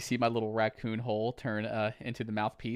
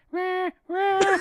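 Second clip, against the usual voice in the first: high squeaky raccoon cry; 9 dB louder than usual. Shown as a demonstration. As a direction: yip like a little raccoon. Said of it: "my raccoon voice".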